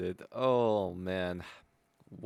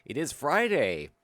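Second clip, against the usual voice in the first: usual speaking voice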